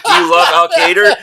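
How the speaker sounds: very high, loud taunting question; retold mock-incredulous goading. Heckler goading an angry woman, high mock incredulity. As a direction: goad her with a loud, high-pitched question.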